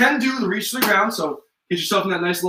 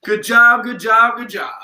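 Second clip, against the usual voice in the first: loud praise; 3 semitones higher, 4 dB louder, slower than usual. Real, not acted. Coach to class after the set: shouted good job. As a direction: cheer the class on.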